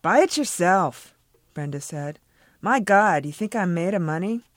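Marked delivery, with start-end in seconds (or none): 0.0-1.1 slow, loud, exasperated put-down; 1 curt refusal. A narrator's reading. Brenda refusing her family, exasperated. 2.6-4.4 exasperated scoff. A narrator's reading. Brenda scoffing at family.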